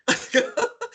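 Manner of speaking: high burst of laughter. Real, not acted. Guest remembering the crazy interview bursts out laughing.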